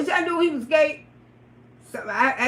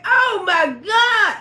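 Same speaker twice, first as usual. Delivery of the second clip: disgusted outcry; 5 semitones higher, slower than usual. Retold or imitated. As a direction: cry out at the stench. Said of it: "I say".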